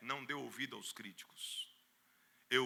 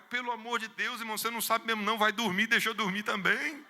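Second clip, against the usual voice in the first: high, loud, fed-up brush-off; irritated midnight scolding; exasperated pastor; 8 semitones higher, 6 dB louder than usual. Retold or imitated. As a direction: shoo him off to bed. Sleepy pastor exasperated at door.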